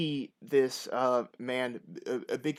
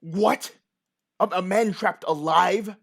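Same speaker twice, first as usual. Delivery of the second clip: loud, higher, incredulous question; shocked disbelief; 6 semitones higher, 9 dB louder, slower than usual. Acted. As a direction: stammer it out loudly, aghast. Farmer reacts to accusation.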